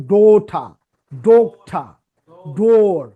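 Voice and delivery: the ও in ডোঠা and ডোর stretched loud and long; long vowel words. Shown as a demonstration. Teacher giving example words for the long vowel, drawn-out vowel. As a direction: hold the ও in each word. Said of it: "ওইগুলো লম্বা হয় না".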